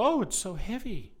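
high, strained "Oh, it's so heavy"; mocking award winners. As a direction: play the humble winner straining. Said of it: "they always go".

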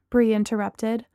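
ordinary delivery

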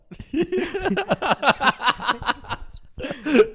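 high hearty laugh; loud burst of laughter. Real, not acted. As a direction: cackle loudly. Big laugh.

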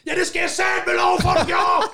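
very high, strained re-enacted outburst; indignant comeback. Man answering stranger who only asked, retold indignant shriek. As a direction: fire back in squealing comic indignation.

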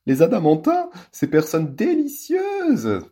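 high, slow, loud mock-laughing gush; loud fake laugh. A narrator's reading. Ambre playing a countess to the visitors, loud fake laughter. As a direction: fake a society laugh very loudly. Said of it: "très fort et en faisant mine de rire".

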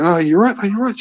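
high, anxious asking; worried. Retold or imitated. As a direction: check she's okay. Checking on her.

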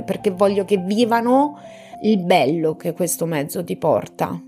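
ordinary talk

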